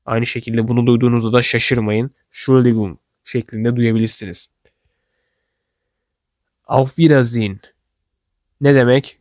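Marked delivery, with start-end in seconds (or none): 2.3-3.0 Entschuldigung without its 'Ent', just 'Schuldigung'; shortened form. Shown as a demonstration. Teacher tells learners what they may hear, shortened Entschuldigung. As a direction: leave off the start of Entschuldigung. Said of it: "kısa bir şekilde söylerler".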